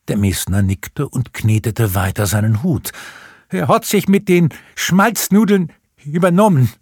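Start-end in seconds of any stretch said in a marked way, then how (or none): none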